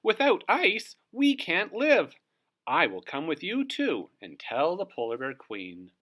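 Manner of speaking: a puffin panicking about its home; high alarmed bird voice. Acted. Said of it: "screeched Puffin Muffin".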